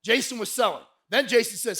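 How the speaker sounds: ordinary talk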